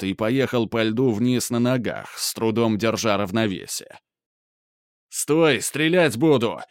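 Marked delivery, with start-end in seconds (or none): 5.1-6.6 shout. Acted. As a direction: shout a threat to halt. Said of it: "кричал мент позади". (крик мента)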